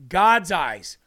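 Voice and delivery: God's punched loud; stress on God's. Shown as a demonstration. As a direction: hit God's hard and loud. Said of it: "This is saying your eyes".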